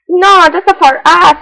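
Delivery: the friends stand firm against bear; firm group refusal. Acted. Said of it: "said the mouse".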